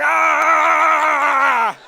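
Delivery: loud hammed-up war cry; over-the-top shouted "Ah!"; mock battle cry. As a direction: scream it like a cartoon raider. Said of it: "Tartar terrorists out there like".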